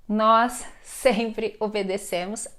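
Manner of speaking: slow, deliberate model sentence; slow example sentence. Shown as a demonstration. Teacher showing students an example with crase, slowly and deliberately. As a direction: give each word room, like dictation.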